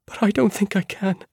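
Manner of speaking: high, pleading and scared; frightened plea. Acted. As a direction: beg off, voice rising in fear. Same words, voiced and pleading.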